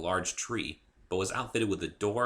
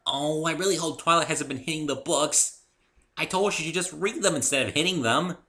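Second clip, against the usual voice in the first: high, loud bouncy pony voice; bubbly pony; 8 semitones higher, 6 dB louder than usual. A narrator's reading. Pinkie explaining Spike to the newcomer, high and bubbly. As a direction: chirp it like a cheerful pony.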